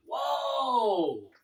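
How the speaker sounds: high, drawn-out falling whoa; long acted exclamation of disbelief; stunned whoa. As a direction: let the shock stretch the word out. Retelling reaction to shocking death news.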